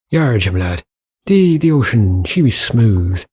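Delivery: lightly put-on Irish sea-dog voice; a hint of a jolly Irish sailor; playful mock Irish brogue; pirate-ish Irish accent. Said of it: "I'm wondering if I'm going a little bit Irish there".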